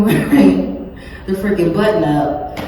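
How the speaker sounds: ordinary talk, nothing marked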